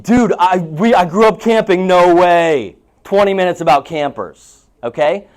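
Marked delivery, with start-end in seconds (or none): 0.0-2.8 high, loud and eager, like a kindred spirit; thrilled agent bonding with a client over camping; excited buddy. Retold or imitated. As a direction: gush about camping to win the client over. Expert pro hooks client on camping.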